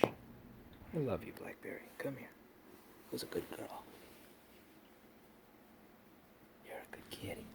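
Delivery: whispering. Real, not acted. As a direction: breathe the words tenderly. Whispering to the cat.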